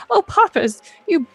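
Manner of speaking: high squeaky worried little voice; little creature voice. Acted. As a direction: warn them like a tiny fretful critter. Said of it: "this little voice again".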